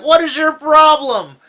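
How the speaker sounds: high, loud indignant shout; imitated high-pitched outburst; mock outraged retort. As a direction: yell it like an offended movie character. Acts angry retort from film.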